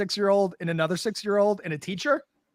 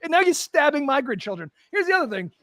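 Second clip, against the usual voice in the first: heated rant; 7 semitones higher than usual. Real, not acted. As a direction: rant at them, voice climbing high. Ranting about Irish history.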